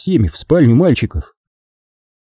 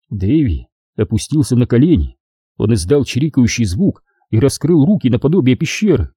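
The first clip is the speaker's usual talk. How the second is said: plain reading voice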